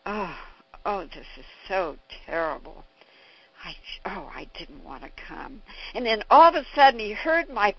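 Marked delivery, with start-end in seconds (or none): 0.0-5.6 frightened inner thought. Retold or imitated. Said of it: "He thought".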